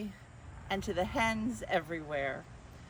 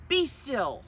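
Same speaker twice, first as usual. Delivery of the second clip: higher, louder firm order; stern command; 6 semitones higher, 7 dB louder than usual. Acted. Max ordering the wild things, a firm command. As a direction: stop the monsters cold. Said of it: "And Max said".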